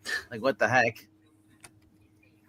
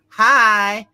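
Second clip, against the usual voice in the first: a high, loud mock-mysterious hello; villain making a mock-mysterious entrance; 9 semitones higher, 8 dB louder than usual. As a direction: ham up a villain's sudden hello. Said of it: "He, he says".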